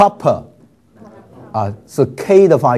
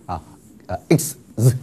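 ordinary talk, nothing marked

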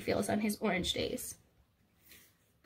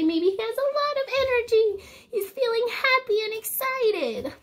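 excited; 11 semitones higher, 4 dB louder than usual. Real, not acted. Explaining the excited yellow day.

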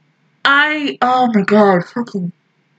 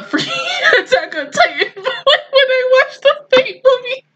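tearful; 13 semitones higher than usual. Real, not acted. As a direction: voice breaking high into crying. Recalls past fear, voice breaks.

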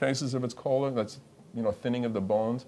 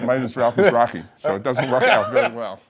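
laughing; 8 dB louder than usual. Real, not acted. Laughing joke about husband.

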